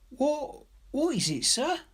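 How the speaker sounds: put-on very high, halting child's voice; timid little girl asking. A narrator's reading. Girl asking Ryder about the bird, very high and halting. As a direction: stammer out the question like a shy child.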